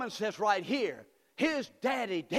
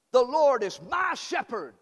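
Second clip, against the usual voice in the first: the verse called out louder; louder congregation call; 4 semitones higher, 7 dB louder than usual. Shown as a demonstration. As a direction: lift it up for the church to echo. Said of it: "One more time".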